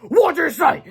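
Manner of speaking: high loud monster demand; monster shout. A narrator's reading. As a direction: shout it like an angry beast. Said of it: "shouted the Turbo Charged Thousand".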